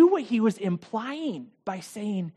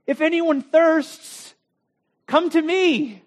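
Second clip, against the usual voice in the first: slow, higher, ringing call to the crowd; proclaiming Jesus; 8 semitones higher, 4 dB louder, slower than usual. Acted. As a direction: call out slowly as Jesus inviting all. Jesus calls out at the feast.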